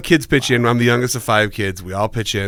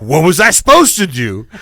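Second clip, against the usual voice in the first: louder, higher mock-defensive dad; retold protest; 4 semitones higher, 5 dB louder than usual. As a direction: imitate dad pleading his case loudly.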